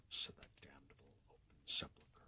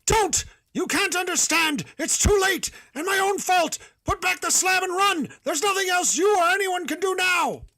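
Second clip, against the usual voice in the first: much higher, much louder anguished screaming; despairing scream; 19 dB louder than usual. Acted. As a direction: scream in utter hopelessness. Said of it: "a scream of utter despair".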